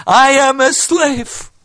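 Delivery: high, loud, drawn-out cry; ringing, stretched-out exclamation; loud self-declaration. Acted. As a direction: proclaim it slowly at full voice, pitched high. Acted outburst of self-realization.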